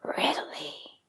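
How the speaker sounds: menacing tiger whisper; snarled whisper. Acted. Tiger accepting the buffalo's duel, menacing whisper. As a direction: hiss it like a predator agreeing. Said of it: "the tiger snarled".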